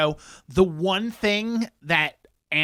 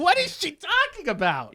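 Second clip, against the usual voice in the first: high, bewildered question; baffled; 11 semitones higher than usual. Retold or imitated. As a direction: ask it, totally lost. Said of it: "They're like".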